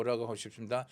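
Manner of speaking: ordinary talk, nothing marked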